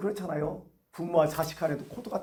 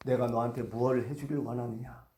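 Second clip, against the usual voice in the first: low, soft, measured question; lightly voiced calm master; Jesus asking; 8 semitones lower, 6 dB quieter than usual. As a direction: ask gently, with quiet authority. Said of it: "예수님이 이걸 보신 거죠".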